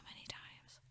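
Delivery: whispered aside, slow; a slow tired whisper. Real, not acted. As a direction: breathe it out wearily. Weary aside.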